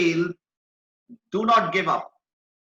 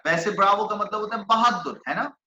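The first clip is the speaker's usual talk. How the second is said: normal talking voice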